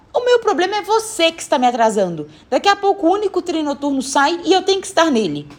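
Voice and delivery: high-pitched, insistent complaint, at normal pace; indignant answer. Acted. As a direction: blame her, in a hurry to leave. Boy answers back, blaming her. (reclamação indignada e aguda)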